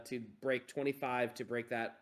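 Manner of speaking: ordinary talk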